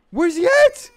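loud high alarmed shout. Real, not acted. Searching the house for the baby, alarmed shout.

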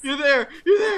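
high, relieved outburst; relieved cry. Real, not acted. Relief finding friend.

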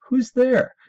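high, loud "who's there"; eager joke response. Acted. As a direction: call out the knock knock reply eagerly. Answering own knock knock joke.

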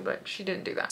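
breathy whispered aside, no voice; a quick confiding whisper. Real, not acted. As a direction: drop to a secret whisper. Aside about the cashier.

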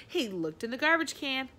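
plain reading voice

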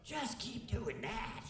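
high, excited wow-wow, then calmer praise; excited mentor. Retold or imitated. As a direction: imitate an excited mentor cheering you on. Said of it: "and Fish goes".

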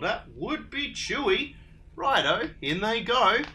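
bright eager agreement; cheerful Dingo. Acted. Dingo agrees with the others, cheery and loud.

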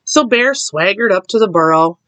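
ordinary delivery, nothing marked

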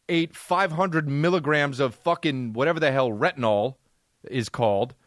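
ordinary talk

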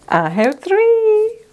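very high, loud, slow squeal; excited squeal. Real, not acted. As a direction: squeal triumphantly. Thrilled at third product.

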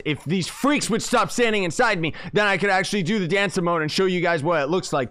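irritated Irish-free rant, high and loud; exasperated rant. Real, not acted. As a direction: vent about the players in the way. Players blocking his dance emote.

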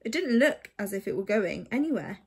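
ordinary delivery, nothing marked

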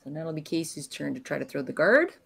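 ordinary talk, nothing marked

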